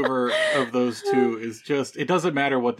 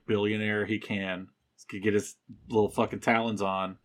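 usual speaking voice

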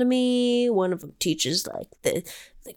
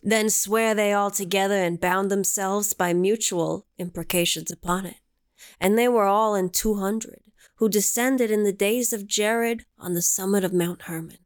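solemn scripture reading; 3 semitones lower than usual. Acted. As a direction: intone it like ancient scripture. Reads scripture aloud.

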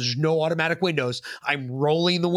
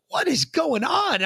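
confused; 14 semitones higher, slower than usual. Retold or imitated. Confused teen being searched.

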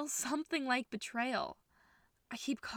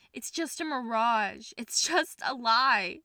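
slow and louder, bitter; despairing outburst; 8 dB louder, slower than usual. Acted. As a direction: spit out the truth. Rising despair, loud slow.

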